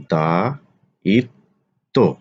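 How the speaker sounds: দায়িত্ব drawn out as dai-tto; slow model pronunciation. Shown as a demonstration. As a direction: say দায়িত্ব slowly for the class. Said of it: "আলোচনা করব শব্দের উচ্চারণ". (দায়িত্ব ধীরে উচ্চারণ)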